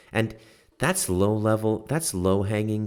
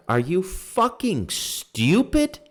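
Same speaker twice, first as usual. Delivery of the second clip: real heated outburst, voice way up and loud; furious rant; 7 semitones higher, 5 dB louder than usual. Real, not acted. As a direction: blow up at them for real. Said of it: "I'm getting heated up".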